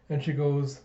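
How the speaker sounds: ordinary talk, nothing marked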